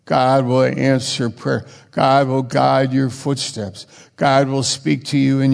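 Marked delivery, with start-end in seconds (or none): none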